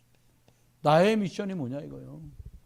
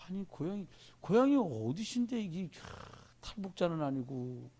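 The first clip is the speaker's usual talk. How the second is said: puzzled guessing; 8 dB quieter than usual. Retold or imitated. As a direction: mimic someone trying to place an accent, drawing words out. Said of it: "애매한 거요".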